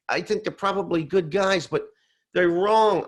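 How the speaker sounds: high, insistent retold protest; agitated denial. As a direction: imitate someone loudly refusing to believe it. Father-in-law insisting they are wrong.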